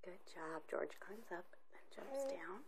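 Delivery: whispering. Real, not acted. Parent reads quietly to child.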